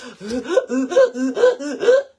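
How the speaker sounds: loud ragged gasp; exhausted gasp. Retold or imitated. Tired mom about to climb the ladder. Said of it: "I'm like".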